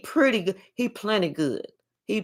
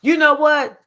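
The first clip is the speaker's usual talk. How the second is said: indignant scolding; 7 semitones higher, 4 dB louder than usual. Retold or imitated. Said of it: "They was like".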